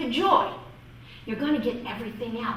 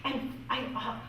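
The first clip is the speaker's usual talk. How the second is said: usual speaking voice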